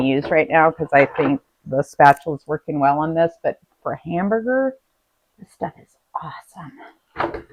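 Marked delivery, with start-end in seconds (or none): 5.4-7.4 hushed, breathy whisper of praise. Acted. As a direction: whisper it like a secret. Showing off the meat mincer.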